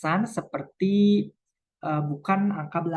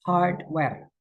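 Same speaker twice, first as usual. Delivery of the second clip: hardware with hard stressed and ware falling away. Shown as a demonstration. Teacher shows compound-word stress on the first word. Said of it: "yang ditekankan adalah kata hardnya".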